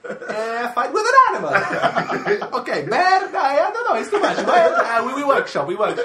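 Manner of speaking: high-pitched laughing rant. Real, not acted. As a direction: squeal it out through laughter. Mocking Italian producers, laughing.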